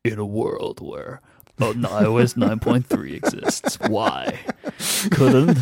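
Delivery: booming movie trailer announcer. Pretend. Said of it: "Can you say that again with the movie trailer voice?".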